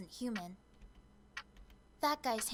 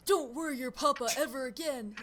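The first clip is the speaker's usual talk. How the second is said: louder, imitated scolding of an adult; mock angry quote; 7 dB louder than usual. Witness imitates Mister Keith's scolding, mock angry. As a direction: act out his telling-off, forcefully. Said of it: "got really mad at me".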